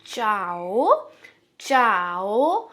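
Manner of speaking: चाओ drawn out slowly with Hindi च; slow Spanish chao. Shown as a demonstration. Teacher demonstrates चाओ slowly to learners. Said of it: "च जैसे हम हिंदी में लिखते हैं".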